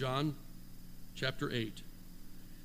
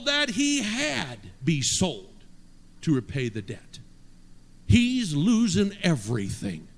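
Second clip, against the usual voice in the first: loud, slow, punched scripture reading; forceful preaching; 6 dB louder, slower than usual. Real, not acted. Preacher reading the servant's plight to the congregation, forceful preaching. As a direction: preach it with big deliberate phrases.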